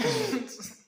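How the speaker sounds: high burst of laughter. Real, not acted. Laughs after joke.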